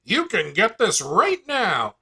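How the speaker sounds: loud, high motivational-speaker pitch. Shown as a demonstration. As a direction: fire them up like a motivational speaker.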